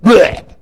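spitting blech. Retold or imitated. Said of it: "you know what he does?".

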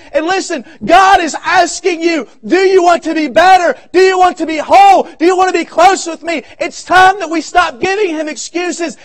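loud, high preaching build, shouting the questions; preaching at full force. Acted. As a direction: press the congregation like a revival preacher. Pastor preaching to congregation.

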